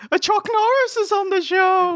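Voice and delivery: high wailing voice; Paul Bearer impression. Host mocking Paul Bearer, high wail. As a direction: raise it to a wail. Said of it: "Paul Bearer's like".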